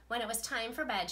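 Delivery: ordinary delivery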